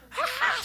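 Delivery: thin, very high little shriek; mock scream. Preacher imitating screams on amusement rides, high mock squeal. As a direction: squeal in falsetto without much volume. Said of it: "소리 지르는데".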